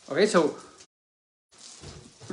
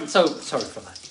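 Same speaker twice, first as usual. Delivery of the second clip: ordinary talk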